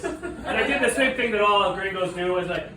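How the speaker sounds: ordinary delivery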